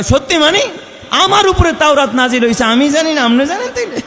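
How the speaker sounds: high, loud incredulous comeback; indignant retort. Acted. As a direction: scoff at the question. Musa retorts in retold argument. (অবাক হয়ে ঝাঁঝালো জবাব)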